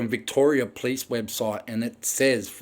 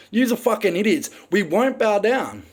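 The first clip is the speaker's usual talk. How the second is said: normal talking voice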